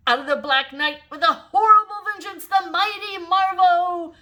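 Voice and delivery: comic-book announcer. A narrator's reading. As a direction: boom it like a superhero intro. Gladys declaims comic book.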